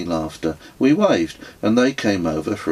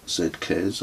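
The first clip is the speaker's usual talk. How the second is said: usual speaking voice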